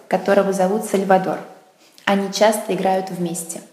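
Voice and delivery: usual speaking voice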